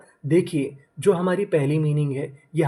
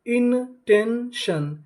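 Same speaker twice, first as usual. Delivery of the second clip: इनटेंशन stretched out very slowly and higher; slow drawn-out model word; 5 semitones higher, much slower than usual. Shown as a demonstration. Teacher to students, slowly blending इनटेंशन. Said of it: "तो हमारी meaning क्या बनी?".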